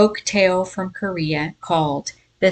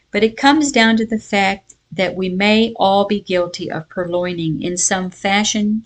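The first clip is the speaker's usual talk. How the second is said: usual speaking voice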